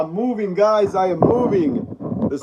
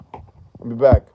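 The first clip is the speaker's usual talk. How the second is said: deep, low movie-killer-robot line; deep movie-villain sign-off; movie robot catchphrase; 9 semitones lower, slower than usual. Pretend. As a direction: drop the voice low and deliver it like a famous catchphrase.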